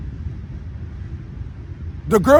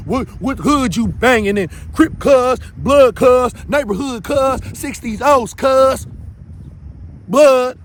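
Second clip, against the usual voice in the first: mock gang roll call; 3 semitones higher, slower than usual. Calling out gang affiliations.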